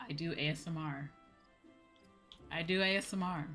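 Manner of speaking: ordinary talk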